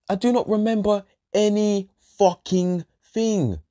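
deliberate word-by-word stress; slow emphatic. Real, not acted. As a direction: spell it out in exasperation. Emphatic restatement of not remembering.

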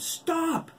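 very high, loud desperate scream; terrified scream for mercy. Acted. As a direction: shriek to stop the attack. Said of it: "Oliver screamed".

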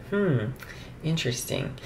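ordinary talk, nothing marked

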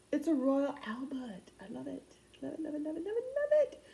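high, gushing mock English voice; bad English accent. Vlogger adoring her Royal Albert find, bad English accent. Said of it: "I go into my very bad English voice".

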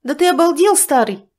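loud high outraged yell; shocked shout at a pinch; indignant shout. Acted. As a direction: yell at the cheeky old man. Pinched, she jumps away.

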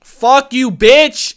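furious shout at the screen; angry yell. Real, not acted. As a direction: yell it at the villain. Yells at the villain.

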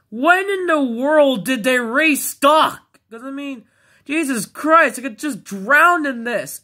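overwhelmed with joy at the restock; excited disbelief. Real, not acted. Teen finds restocked toys, excited disbelief.

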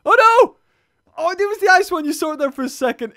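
surprised outcry. Real, not acted. Spotting a missed shark.

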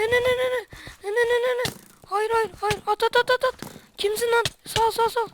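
high, frantic panic while fleeing; shrieking run, run in terror; being chased. Real, not acted. Chased by enemy in game.

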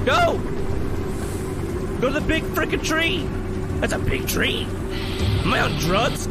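screaming high and loud; panicked shout. Real, not acted. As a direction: yell in sheer panic.

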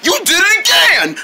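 high, excited shout; excited cheer. Acted. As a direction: cheer a fighter on at high pitch. Friend cheers the attack.

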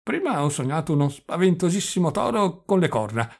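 put-on high, loud old man's voice; doddering old prince, musing. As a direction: recall a dream with muddled conviction. (vecchio principe svanito, voce alta e acuta)

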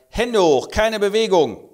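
high, loud police command; mock stern police shout; shouted order. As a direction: freeze them with a barked order.

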